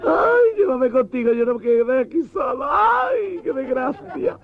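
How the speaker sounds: very high, wailing camp sob; dramatic crying widow. Acted. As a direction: overact the grief of a heartbroken lover. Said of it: "con la vena dramática llorando".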